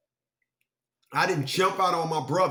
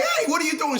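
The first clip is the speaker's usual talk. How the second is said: high, louder shout of surprise; startled yell; 12 semitones higher, 3 dB louder, faster than usual. Retold or imitated. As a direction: yell in shock at the dog.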